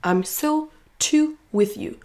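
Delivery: words spaced out slowly, one by one; only the small words, slowly set apart; function words only. Shown as a demonstration. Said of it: "on garde seulement les mots de fonction". (mots de fonction seuls, lents et détachés)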